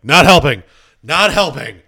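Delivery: shouted protest from a spinning ride; retold yell. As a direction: yell it out like mid-ride panic. Retelling own yells on ride.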